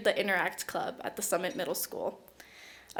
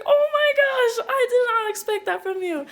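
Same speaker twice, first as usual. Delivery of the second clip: very high, loud imitation of shock, laughing; startled; 5 dB louder than usual. Nominator reacts to the winner's tears, hammed-up surprise. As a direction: squeal it as the astonished nominator.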